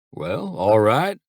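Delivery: drawn-out, unhurried "Well, all right"; slow drawl. Acted. Dad answering his sons on the phone, slow drawl. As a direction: let each word stretch lazily. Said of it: "Dad said in his slow drawl".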